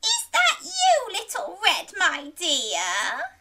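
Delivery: very high, loud granny call. Acted. Granny calling to Little Red at the door, high calling voice. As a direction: call out high, easing down toward the end. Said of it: "called Granny".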